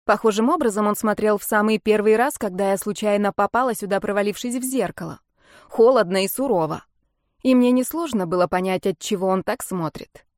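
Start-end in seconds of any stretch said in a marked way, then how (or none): none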